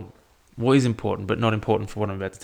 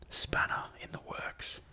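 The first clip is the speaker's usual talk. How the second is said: a sly whispered aside to himself; 13 dB quieter than usual. Real, not acted. Podcaster whispering a joke aside to listeners. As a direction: drop to a breathy whisper, half joking.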